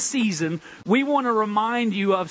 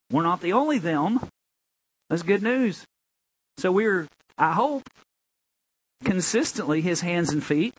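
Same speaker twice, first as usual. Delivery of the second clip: ordinary talk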